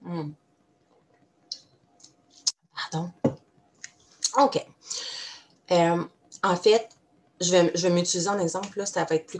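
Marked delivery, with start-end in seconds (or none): 0.0-3.1 while sipping a drink. Real, not acted. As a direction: mouth just off a sip of tea. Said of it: "Je vais prendre une petite gorgée de thé".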